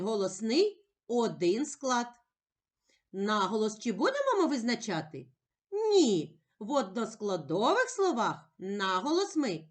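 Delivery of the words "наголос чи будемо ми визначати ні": ordinary talk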